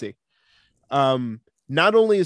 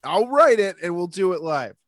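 imitated rant; 4 semitones higher than usual. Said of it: "Best rant of all time".

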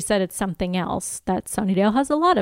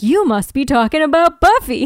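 loud, higher-pitched mock pounce; imitating Angelus; 5 semitones higher, 7 dB louder than usual. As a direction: jump in loudly as the villain in a retelling. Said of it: "jumps out and is like".

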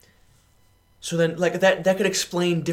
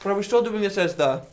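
talking through a mouthful of food; words mushed around a bite; chewing while talking; 5 semitones higher than usual. Real, not acted. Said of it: "sorry, I'm chewing".

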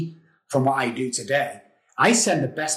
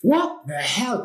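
baffled; 8 semitones higher, much slower than usual. Retold or imitated. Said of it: "I'm like".

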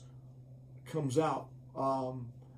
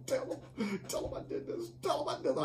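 urgent half-whispered pleading; the flesh nagging to be noticed; mock inner voice begging for credit; whining flesh; 9 semitones higher, 3 dB quieter, faster than usual. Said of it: "your flesh is saying".

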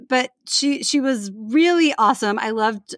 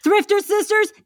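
shouted announcement; 10 semitones higher than usual. Acted. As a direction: belt it out like a game show host. Surprise shouted show title.

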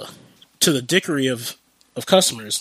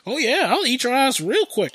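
mock eager; 10 semitones higher than usual. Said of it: "who be like".

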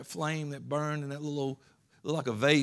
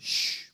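hushing shush. Acted. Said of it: "anything they can to hush you".

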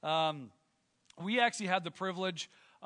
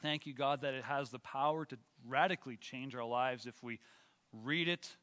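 prayer; 3 semitones lower than usual. Acted. Leads a prayer.

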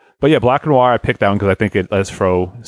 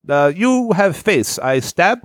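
slow, higher mock Russian accent; a silly Russian tough guy, drawn out; hammed-up Russian accent, slow; 9 semitones higher, much slower than usual.

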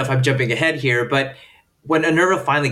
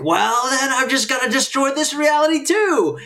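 high-pitched mocking quote, pitch held up to the end; mock petulant declaration; 12 semitones higher than usual. Thwarted mother vows to destroy this reality, mock petulant. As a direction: mimic her in a high put-on voice. Voicing character's rash decision.

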